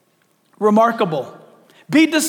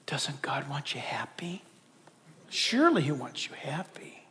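soft persuasive whisper; tempter's whisper; 7 semitones lower, 13 dB quieter than usual. Acted. Satan tempting believers with happiness, soft persuasive whisper. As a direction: lure them gently in a whisper. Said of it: "this is what Satan will do".

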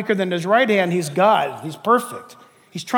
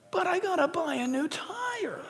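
higher, soft, whiny objection; whiny complaint; 8 semitones higher, 10 dB quieter than usual. Pretend. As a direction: complain like a put-out child. Believer complains.

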